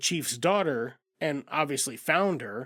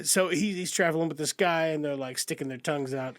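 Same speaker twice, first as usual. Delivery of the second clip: ordinary talk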